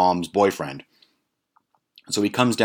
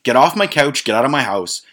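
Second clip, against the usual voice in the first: raised-pitch mock yell; imitated angry order; 5 semitones higher, 4 dB louder than usual. Chris ordering Dale off his couch, mock angry. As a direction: act out a worked-up homeowner.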